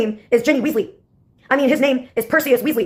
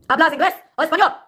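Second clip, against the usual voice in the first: higher, mocking Spanish question; Spanish taunt; 5 semitones higher, faster than usual. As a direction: needle her in Spanish.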